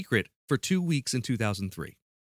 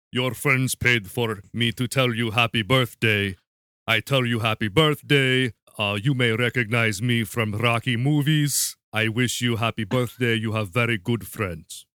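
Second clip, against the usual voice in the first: put-on Russian accent; 2 semitones lower than usual. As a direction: put on a hammy Russian cameo greeting. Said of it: "I wanna hear Russian".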